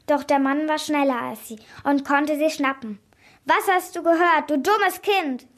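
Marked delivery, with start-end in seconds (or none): 3.4-5.5 loud, high, menacing demand; angry interrogation. A narrator's reading. Man grabs the eavesdropping child, angry and loud. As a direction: berate a caught eavesdropper. Man threatens the caught girl.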